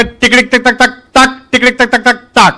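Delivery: clicking code-talk imitation; telegraph code chatter. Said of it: "એક જણો કે".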